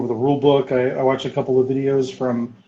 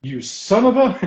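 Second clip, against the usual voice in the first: higher, amused accusing voice; playfully accuse, on the edge of laughter; mock outrage; 9 semitones higher than usual. Gamer realising who bought all the stock, mock outraged. Retold discovery of rival buyer.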